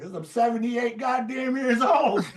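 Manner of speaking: put-on high, laughing through the old player's retort; mock old-man retort dissolving in laughter; laughing punchline. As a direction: crack up while delivering the punchline. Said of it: "And I said".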